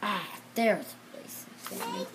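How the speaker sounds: ordinary delivery, nothing marked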